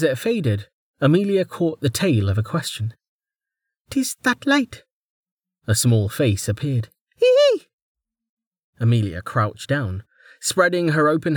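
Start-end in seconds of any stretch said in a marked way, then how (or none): 3.9-4.8 put-on child voice. A narrator's reading. Small child answers from dark. 7.2-7.7 high, louder little giggle; child's high giggle. A narrator's reading.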